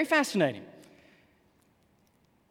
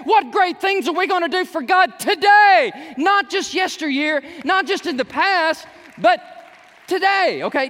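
loud, high, driving preacher voice; full-force preaching; 9 semitones higher, 7 dB louder than usual. Real, not acted. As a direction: preach it to the back row.

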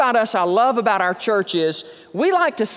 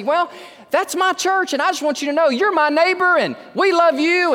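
high, quick, eager retold greeting; knock-on-the-door enthusiasm, pitched high; 9 semitones higher than usual. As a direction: sound thrilled to meet the neighbor. Pastor retelling his door greeting.

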